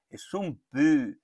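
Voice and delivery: "pu" with lips rounded, slow; rounded u sound. Shown as a demonstration. Teacher shows the rounded Finnish vowel, slow. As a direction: push the lips out for "pu". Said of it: "Fíjate, esto no es".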